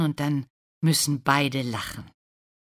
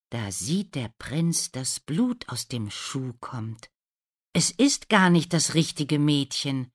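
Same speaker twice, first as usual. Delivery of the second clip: usual speaking voice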